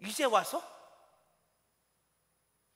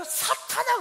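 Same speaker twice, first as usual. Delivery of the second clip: higher, sharp rebuking cry; mild retold rebuke of Peter; imitated rebuke; 5 dB louder, much slower than usual. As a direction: call it out as a rebuke. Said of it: "예수님이 베드로를 향해서".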